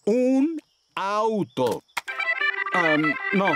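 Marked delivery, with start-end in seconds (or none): 0.0-1.8 very slow, each word drawn out; slow, spelled-out speech. Acted. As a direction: say it slowly so they understand. Speaking slowly to a foreigner. (muy despacio, palabra por palabra)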